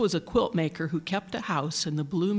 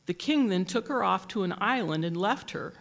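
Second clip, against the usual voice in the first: plain reading voice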